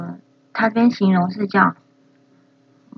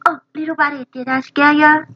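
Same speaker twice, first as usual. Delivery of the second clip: higher, gentle cartoon shark; imitating Lenny; 8 semitones higher than usual. Learner echoing Lenny comforting the worm, higher and gentle. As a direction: coo it to a frightened little worm. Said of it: "copies Lenny clip".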